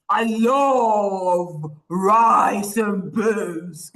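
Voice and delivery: very slow, drawn-out lion roar. Shown as a demonstration. Coach shows children favourite food, roared like a lion. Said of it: "like you're roaring, like you're a lion".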